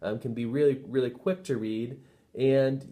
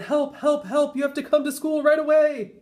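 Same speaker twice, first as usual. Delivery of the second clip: much higher, louder urgent plea; urgent raised cry for help; calling for help; 14 semitones higher, 6 dB louder than usual. Acted. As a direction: beg mom down the phone. Ben calls his mother on phone.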